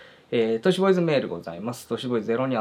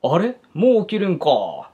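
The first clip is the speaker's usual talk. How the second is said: high, slow dialect voice of an unknown man; louder, drawn-out regional Japanese accent; 5 semitones higher, 5 dB louder than usual. Acted. Unknown man at a sleeping child's side, heavy regional accent. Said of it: "とてもなまった声がはっきりと".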